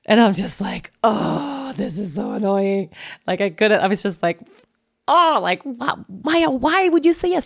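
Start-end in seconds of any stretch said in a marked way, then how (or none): 1.0-2.9 a whiny groan at the border desk; annoyed. Retold or imitated. Retelling her annoyance at Maya, exasperated. Mock whiny quote of herself. 5.1-7.5 high, incredulous complaint; exasperated. Retold or imitated. Scolding Maya for saying yes, incredulous and high.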